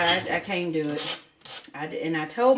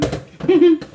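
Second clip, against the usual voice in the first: sudden loud laugh, pitched up; loud burst of laughter; 8 semitones higher, 11 dB louder than usual. Real, not acted. Laughs at own Southern joke.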